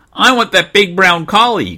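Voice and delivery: put-on high, loud child voice; little boy crying out. Small boy picking a dog at the kennel, high loud cry. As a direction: pipe up like an eager kid. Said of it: "cried a thin little boy".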